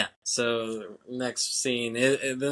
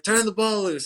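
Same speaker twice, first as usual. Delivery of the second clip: high-pitched retold yell; imitating an exasperated shout; mock exasperated yell; 9 semitones higher, 4 dB louder than usual. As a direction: yell it like a fed-up coach, hammed-up. Retold exasperated man yelling at kid.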